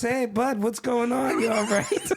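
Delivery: high mock-friendly cop, laughing through it. Mock-concerned cop greeting the driver, laughing throughout. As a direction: play the cop checking in while cracking up. Cop pulls up, voiced by teller.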